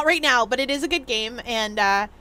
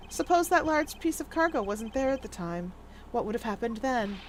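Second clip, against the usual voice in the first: soft, measured character voice; understated, quiet courtroom reasoning; composed, probing investigator; 7 dB quieter than usual. Acted. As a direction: pose the hypothetical quietly and calmly.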